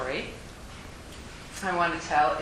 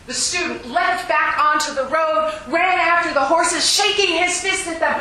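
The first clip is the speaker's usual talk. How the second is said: higher, louder, building narration; rising excitement; 8 semitones higher, 8 dB louder than usual. A narrator's reading. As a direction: let the tension climb. Narration builds with angry student.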